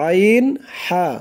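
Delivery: আইন, হা drawn out slowly from a squeezed mid-throat; Arabic ain and ha from the middle of the throat. Shown as a demonstration. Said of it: "গলা চিপে".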